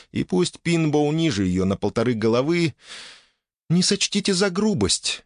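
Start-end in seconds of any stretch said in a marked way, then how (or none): 3.7-5.2 higher, politely embarrassed; a hint of a shy elf lady. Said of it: "несколько смущённо произнесла леди".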